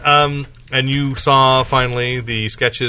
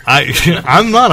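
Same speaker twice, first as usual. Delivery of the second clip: usual speaking voice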